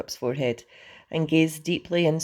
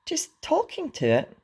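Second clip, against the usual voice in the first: high-pitched, incredulous echo; astonished gasp. A narrator's reading. Fishlegs echoes Hiccup's confession, gasping in astonishment. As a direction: throw it back in astonishment, voice shooting up. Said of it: "gasped Fishlegs in astonishment".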